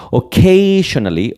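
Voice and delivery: Occasionally said slowly as "okeshonali", starting like "ok"; teacher modelling Occasionally slowly for students; careful pronunciation. Shown as a demonstration. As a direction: stretch out Occasionally, opening with "ok". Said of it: "ojo con la pronunciación".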